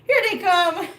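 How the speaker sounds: high, loud, breaking into laughter; laughing delight. Real, not acted. Owner seeing her cats finally coming, laughing announcement.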